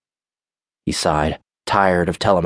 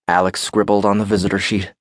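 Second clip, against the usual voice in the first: ordinary delivery, nothing marked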